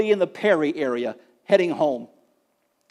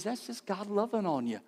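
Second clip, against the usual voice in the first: gentle mother; 11 dB quieter than usual. Retold or imitated. And she says.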